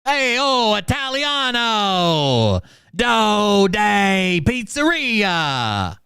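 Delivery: high, drawn-out mock Italian singsong; fake Italian. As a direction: ham up a fake Italian accent, very slow. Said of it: "now he's speaking fake Italian".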